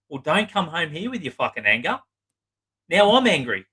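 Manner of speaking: acting out the wife flaring up; mock angry retort. Wife flares at husband after his confession, mock angry. Wife snaps back in therapy.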